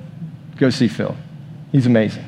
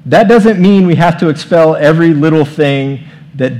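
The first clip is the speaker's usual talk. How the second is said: higher and louder, pressing the point; forceful quote; 4 semitones higher, 7 dB louder than usual. Retold or imitated. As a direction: lean hard into the quote. Quoting Wimber, forceful.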